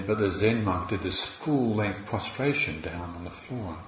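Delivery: ordinary talk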